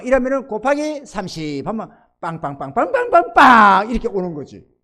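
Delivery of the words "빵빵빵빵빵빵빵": string of bangs building louder; escalating popping noises; imitated rapid bangs. Shown as a demonstration. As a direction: fire off bangs, rising to a big one.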